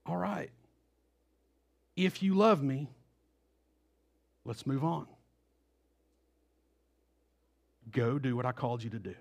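soft, quiet, forgiving; gentle, not angry. Acted. Jesus gently forgiving Peter, quietly moving on. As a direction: let it go gently and send him on. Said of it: "he doesn't say it in an angry voice".

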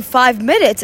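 ordinary delivery

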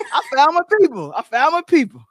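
loud laughing joy. Real, not acted. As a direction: laugh it out gleefully. Laughing joy about group.

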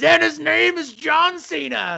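high, slow, booming ring announcer; drawn-out hype intro call; wrestling announcer. Retold or imitated. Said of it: "I gotta wait for the soundbite to be over".

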